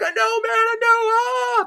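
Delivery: very high, slow, squealing panic; shrill drawn-out panic ending in a yelp; panicked whine. Acted. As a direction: whimper it out, terrified. Dante panicking before Medusa.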